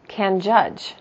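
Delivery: ordinary talk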